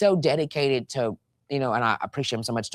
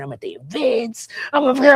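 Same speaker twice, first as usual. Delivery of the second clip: excited, rising to a high, loud peak; 10 semitones higher, 5 dB louder than usual. Real, not acted. Host listing everywhere she gets taken, voice climbing excitedly. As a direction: build the list up to a shout.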